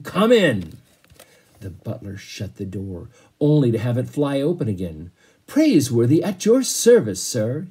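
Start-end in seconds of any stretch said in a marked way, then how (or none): 0.0-0.7 higher, louder gruff order; roaring command. Acted. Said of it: "in a voice like the roar of a cannon". 5.5-7.7 polite butler. Acted. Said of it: "he said".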